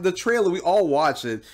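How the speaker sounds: ordinary talk, nothing marked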